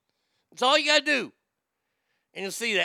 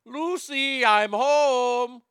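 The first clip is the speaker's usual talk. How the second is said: higher, loud sitcom husband call; 5 semitones higher, 5 dB louder, much slower than usual. Acted. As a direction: sing out the catchphrase as you come home. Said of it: "to play [name]".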